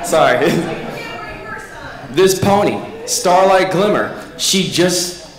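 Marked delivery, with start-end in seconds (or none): 0.6-2.1 high-pitched burst of laughter. Real, not acted. Laughs after correction.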